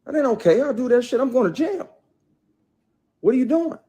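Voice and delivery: high, loud, fast outraged yell; reliving confronting a coworker, high and heated; retold angry check. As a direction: snap at her to stop, voice shooting up. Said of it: "checked her, like".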